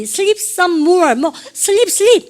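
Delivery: high, loud imitation of learners' English; mock Konglish. Teacher imitating students' Konglish attempts, mock-emphatic.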